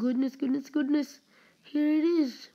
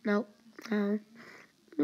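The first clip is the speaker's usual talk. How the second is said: ordinary talk, nothing marked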